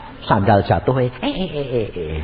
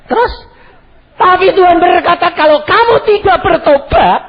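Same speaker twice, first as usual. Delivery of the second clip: loud, high preaching call; fiery sermon voice; 9 dB louder than usual. Acted. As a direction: preach it out to the hall. Preaching God's warning.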